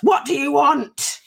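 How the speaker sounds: slow, loud bark of a demand; mock army general yell; retold yell. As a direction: draw it out like an angry general. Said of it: "he yells at us".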